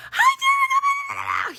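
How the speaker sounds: very high, loud, slow sing-song hello; overly cheery greeting. Retold or imitated. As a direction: draw out a gushing hello. Imitating the aide's greeting again.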